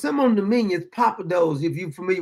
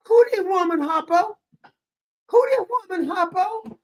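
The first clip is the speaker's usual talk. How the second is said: very high, baffled questioning; hammed-up disbelief, voice pitched way up; mock bewilderment; 11 semitones higher than usual. Asking Hoppo who this woman is, mock bewildered. Acts out not recognizing her.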